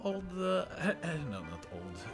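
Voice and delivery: usual speaking voice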